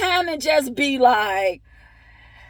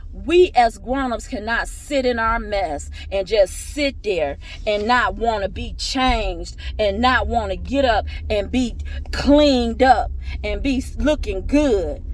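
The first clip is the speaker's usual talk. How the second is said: normal talking voice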